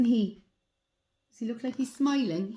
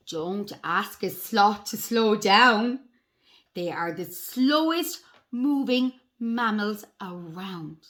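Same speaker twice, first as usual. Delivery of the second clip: slow, deliberate storybook reading; picture-book read-aloud; 3 dB louder, slower than usual. A narrator's reading. Reading sloth facts to children, slow storybook voice. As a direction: read slowly to young children, weighting key words.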